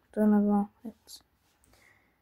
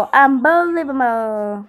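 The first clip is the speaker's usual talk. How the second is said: slow, louder game-announcer Unbelievable; stretched, hyped mock announcer cheer; 2 semitones higher, 6 dB louder, much slower than usual. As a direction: drag Unbelievable out like the game's voice. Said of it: "η προφορά μου είναι τέλεια".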